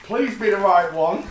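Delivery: loud, high, laughing hope; half-laughing prayer that it's right; nervous laughing plea. Real, not acted. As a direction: beg the box through a laugh. Nervously opening the parcel.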